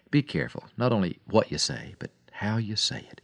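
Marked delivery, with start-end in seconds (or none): none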